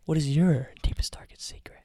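spooky unvoiced whisper. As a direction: whisper it like a secret ritual. Mocking mood setting whisper.

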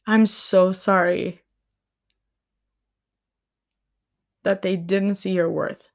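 fighting back tears while saying sorry; real emotion welling up, words held back; close to tears. Real, not acted. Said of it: "I just feel this really strongly and I'm gonna cry".

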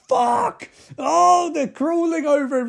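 high, loud hammed-up horror; overacted victim's cry; mock panic. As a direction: shout as if insects swarm you. Acting out victim covered in insects.

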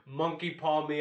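ordinary delivery

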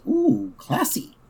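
very high, slow, airy cartoon dad admiring a card; Homer impression. Dad admiring a lawyer's card, cooing high and slow. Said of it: "Homer goes".

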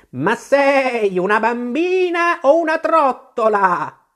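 high, slow, bleating-long vowels, loud; an exasperated sheep stretching out every stressed vowel; scolding sheep. Acted. The sheep scolds Alice for spinning round, high drawn-out scolding.

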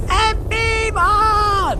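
very high, long-held hurrah; shouted cheer. A narrator's reading. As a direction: cheer at the top of the range, drawn out. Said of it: "gridò ancora nonno Joe".

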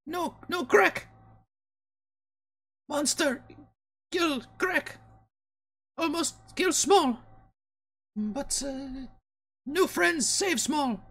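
squeaky little kobold, halting; high creature voice, slow at the end. Retold or imitated. Kobold retelling danger to party, high halting voice. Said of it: "she's like".

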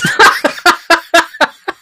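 high burst of laughter; laughing hard. Real, not acted. Telling his mate the Booker T story, laughing hard. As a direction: crack up mid-story.

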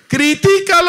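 high, loud devil's prod; tempting devil. Acted. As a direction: egg them on like a sly devil. Devil returns with same temptation.

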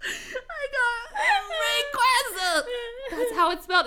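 high, teary laughing; crying with laughter. Real, not acted. Reading the fic aloud, crying with laughter. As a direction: squeak out words through tears.

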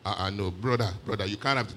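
ordinary talk, nothing marked

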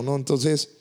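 ordinary delivery

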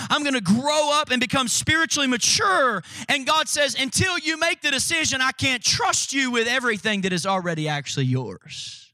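earnest sermon appeal; preaching. Acted. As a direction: press the point home to the congregation. Preaching application.

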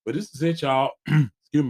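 stuffy congested voice. Real, not acted. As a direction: speak through a blocked nose. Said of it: "my voice, I'm a little congested".

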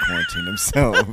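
high laughing through words. Real, not acted. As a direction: laugh as you say it. Laughing joke about friend.

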